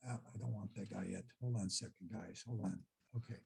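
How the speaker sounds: very soft, low aside; hushed aside. Real, not acted. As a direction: drop right down, as if talking to yourself. Aside while hunting for a slide.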